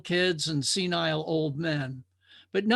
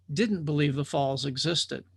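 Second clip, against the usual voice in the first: ordinary delivery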